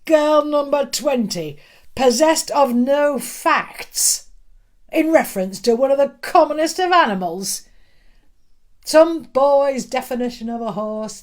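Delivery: loud, lecturing the whole class; teacher declaiming to the class; booming schoolmaster. Acted. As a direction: shame her before everyone. Schoolmaster scolds before class.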